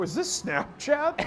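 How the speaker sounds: imitated lady. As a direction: lightly mimic a puzzled lady, slowly. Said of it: "Did some lady just go".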